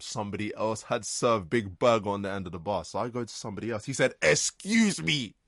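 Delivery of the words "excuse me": loud, high, drawn-out call; mock an entitled customer stretching out the words; demanding customer. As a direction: call out indignantly for service. Acting customer demanding attention.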